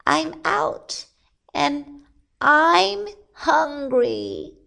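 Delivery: high, slow squeaky rabbit; rabbit character. A narrator's reading. Rabbit freed after two days, high hungry voice. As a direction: stretch it out in a high little voice.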